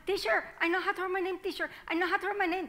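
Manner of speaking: high, quick, eager pleading; eager child. Acted. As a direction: bounce with excitement to get the teacher's attention. Child begging the teacher again.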